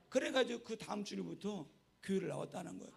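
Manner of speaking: ordinary talk, nothing marked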